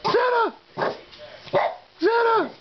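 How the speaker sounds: very high excited call; excited squeal. Acted. Owner hyping up the pets with a very high squeal. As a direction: squeal the name like a thrilled kid.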